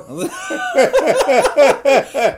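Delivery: high burst of laughter. Real, not acted. Laughs at gun remark.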